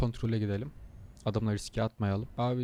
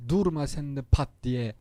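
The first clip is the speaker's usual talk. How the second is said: much louder and higher, annoyed snap; angry; 4 semitones higher, 9 dB louder than usual. Real, not acted. Annoyed driver scolds truck that braked suddenly, loud angry outburst. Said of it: "Sinirlendim ya".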